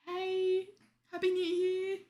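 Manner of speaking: tossing off a cheery hi to chat; chirpy greeting. Retold or imitated. Greeting chat in a light, high cheery voice. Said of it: "meant to be a quick".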